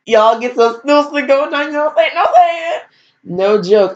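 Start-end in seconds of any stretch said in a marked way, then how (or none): 0.1-2.8 mock relative. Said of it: "I'd be like".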